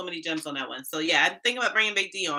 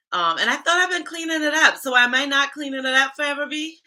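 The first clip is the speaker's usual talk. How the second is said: high, louder, playfully offended; mock indignation; 9 semitones higher, 5 dB louder than usual. Real, not acted. Answering a viewer's accent comment, mock offended. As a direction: act shocked at the viewer.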